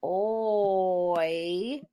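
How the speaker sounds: "K-oy" as bare K, then long OY; sounded out. Shown as a demonstration. Teacher sounding out spelling for pupils, k, oyyy. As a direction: hold the vowel of "K-oy". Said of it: "Remember, O I says oi".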